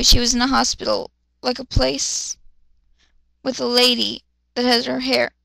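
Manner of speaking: normal talking voice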